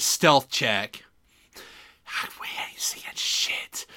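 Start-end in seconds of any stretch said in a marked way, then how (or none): 2.4-3.9 hushed whisper, barely voiced. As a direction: whisper it like hiding. Whispered in-game aside.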